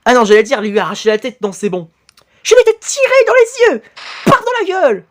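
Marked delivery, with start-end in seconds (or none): 2.4-5.0 high villain voice. Acted. Voicing a fighting character.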